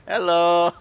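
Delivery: high, loud, teasing hello; mock greeting. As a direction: sing out a loud, high hello to taunt him. Taunting the enemy Vlad.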